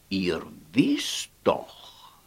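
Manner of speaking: low, slow confiding whisper. Acted. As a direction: whisper it secretly. Old man whispers to the fairies.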